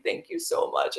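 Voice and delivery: high, breathy-soft tearful thank you. Retold or imitated. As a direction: retell the thanks as if crying again. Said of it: "crying, like".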